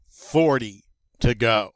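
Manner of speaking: ordinary talk, nothing marked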